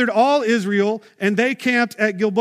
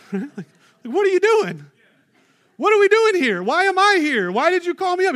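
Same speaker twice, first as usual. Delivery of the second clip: high, exasperated grumbling ghost; high indignant old prophet's voice; annoyed ghost Samuel; 8 semitones higher than usual. Pretend. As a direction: play the irritated prophet, woken up. Samuel annoyed at being summoned.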